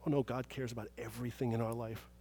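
ordinary talk

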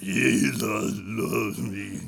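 slow, low, croaking rasp; raspy croak. Retold or imitated. New student singing for the music teacher, slow low raspy croak. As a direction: croak it out through a wrecked throat. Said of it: "That's about the way my throat sounded, all raspy".

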